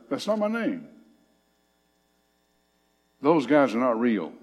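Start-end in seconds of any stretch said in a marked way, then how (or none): 0.1-0.9 God's voice. Acted. Preacher voicing God rejecting idols.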